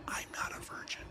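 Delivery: whispered confession. Real, not acted. Telling the chat in a hushed whisper. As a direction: breathe it out with no voice at all. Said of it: "Just for records".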